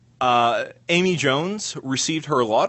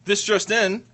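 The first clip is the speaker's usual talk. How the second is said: news anchor voice; 6 semitones higher than usual. Pretend. Mock news bulletin.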